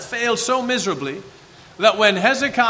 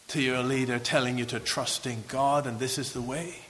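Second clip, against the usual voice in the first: usual speaking voice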